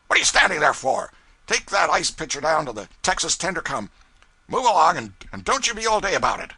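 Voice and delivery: loud, high bark, rushed at the end; angry orders. A narrator's reading. Pilot chasing the cub off with orders, loud and high. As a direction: drive him off with orders.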